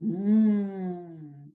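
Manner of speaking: humming mm; letter sound m. Shown as a demonstration. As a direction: hum the M sound. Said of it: "M says".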